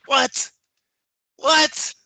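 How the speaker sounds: high, loud disbelieving cry; incredulous what. Real, not acted. Host reacting in disbelief to the champ's boast, high and loud. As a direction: throw up your hands in disbelief.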